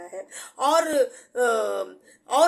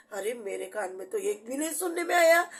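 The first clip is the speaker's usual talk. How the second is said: usual speaking voice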